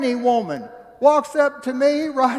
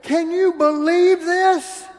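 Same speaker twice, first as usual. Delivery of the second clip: amazed; 8 semitones higher, 5 dB louder, slower than usual. Retold or imitated. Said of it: "I looked at Cindy and I said".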